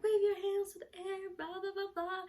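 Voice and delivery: high singsong crowd chant; imitated party cheer. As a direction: mimic dancers cheering along. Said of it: "they were like".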